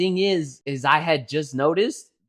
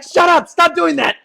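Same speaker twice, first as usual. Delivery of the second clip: loud, high-pitched scolding; retold angry yell; 12 semitones higher, 8 dB louder than usual. Scolding the screaming kid, mock-furious shout. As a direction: yell at a kid to stop, re-enacted.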